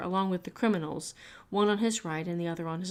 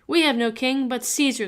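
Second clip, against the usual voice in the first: crowd reply; 5 semitones higher, 8 dB louder than usual. A narrator's reading.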